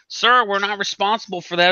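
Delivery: high-pitched mock official voice; mocking customer service refusal; dismissive company rep. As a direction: imitate a rep refusing blame, voice pitched right up. Mimics rep denying responsibility.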